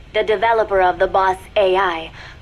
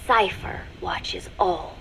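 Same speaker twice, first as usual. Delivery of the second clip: slow and softer, ominous; ominous hush; 6 dB quieter, slower than usual. Acted. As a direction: let it sink in slowly and quietly. Ominous line about the watcher.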